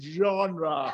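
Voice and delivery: playful French-accented "Genre". Shown as a demonstration. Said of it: "Gotta have that French accent".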